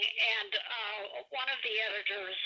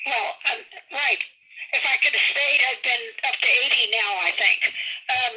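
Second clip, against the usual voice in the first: big hall echo; 2 semitones higher, 8 dB louder than usual. Real, not acted. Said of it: "there's some reverb".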